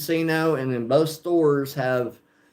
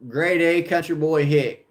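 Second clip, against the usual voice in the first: ordinary talk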